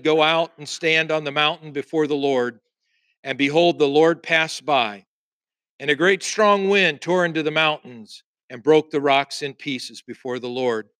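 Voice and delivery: ordinary delivery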